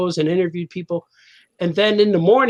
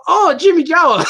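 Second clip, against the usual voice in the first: high, loud mock exclamation; sudden excited "oh, him!"; delighted recognition; 8 semitones higher, 5 dB louder than usual. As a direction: act out the penny dropping, pitched up and loud. Uncle suddenly recognising the nickname.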